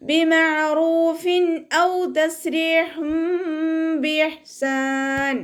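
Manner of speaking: slow, high, drawn-out Quranic chant; measured tajweed recitation. Shown as a demonstration. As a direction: recite it in tajweed, stretching each sound. Recites verse between explanations.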